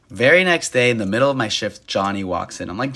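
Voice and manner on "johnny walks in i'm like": normal talking voice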